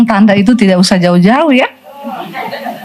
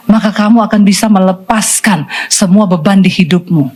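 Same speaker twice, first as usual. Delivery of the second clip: ordinary talk, nothing marked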